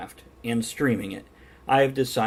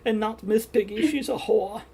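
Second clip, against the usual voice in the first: high-pitched, hammed-up German character voice; Gunter's German accent; 12 semitones higher than usual. Streamer riffing on Piggy power as the Sing character, German accent. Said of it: "Just because Gunter was so positive".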